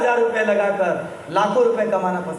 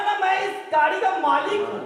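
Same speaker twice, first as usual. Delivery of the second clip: high-pitched mock self-importance; retold huffy claim of status; indignant insistence; 7 semitones higher than usual. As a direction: imitate a driver proudly correcting someone. Retold driver insists he is owner.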